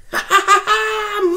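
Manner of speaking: high cackling villain laugh; mock evil cackle. Doctor gloating over the cop, mock evil cackle. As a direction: cackle like a gleeful madman.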